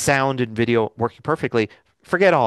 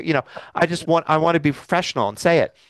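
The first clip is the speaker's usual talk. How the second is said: ordinary talk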